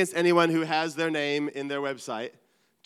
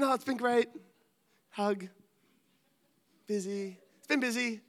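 fake cheery; 5 semitones higher, 5 dB quieter than usual. Fake church face answer.

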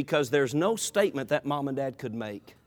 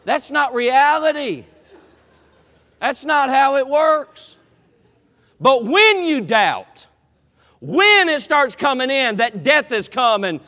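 preaching emphasis; 8 semitones higher, 7 dB louder, slower than usual. Acted. Preacher's slow emphatic turn.

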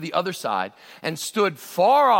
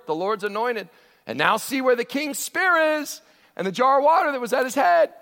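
taunting call; 7 semitones higher, 3 dB louder than usual. Acted. David taunting Abner from afar.

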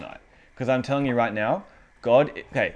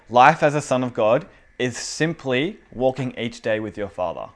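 ordinary talk

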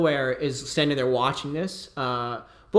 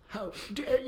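a much softer, seething repeat; fuming; 8 semitones higher, 7 dB quieter than usual. Acted. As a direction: hiss it under the breath in fury. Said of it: "He's, like, fuming".

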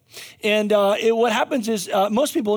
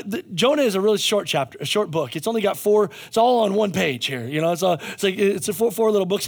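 normal talking voice

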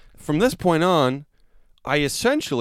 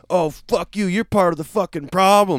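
higher, mocking the heckler's snarl; mock angry man; 5 semitones higher than usual. The anti-masker firing back, mock angry. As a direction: imitate him lashing back. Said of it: "He goes".

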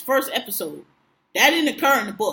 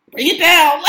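commanding Jocelyn; 4 semitones higher, 7 dB louder than usual. Retold or imitated. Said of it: "Jocelyn does this hand motion".

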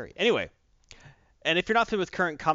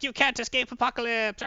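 higher, put-on villain cry; mock villain shout; 10 semitones higher than usual. Dog riders calling after fleeing John, higher mock villain cry. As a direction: shout it like a cartoon henchman.

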